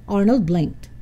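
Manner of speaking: ordinary delivery, nothing marked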